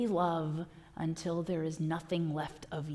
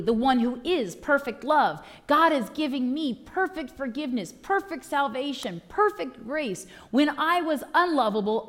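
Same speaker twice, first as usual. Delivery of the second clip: higher, building preached praise; preaching at full force; 4 semitones higher than usual. Acted. Preacher proclaiming God's gifts to the congregation, building praise. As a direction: lift it up in praise.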